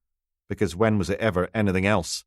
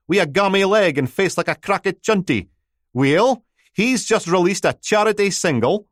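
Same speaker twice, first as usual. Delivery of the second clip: broad Doric, high and loud chatter; chirpy local radio patter; Aberdeen radio DJ; 10 semitones higher, 5 dB louder than usual. Acted.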